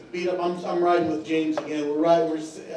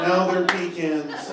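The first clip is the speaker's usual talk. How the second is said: slow punchline; much slower than usual. Pretend. Punchline delivered slowly.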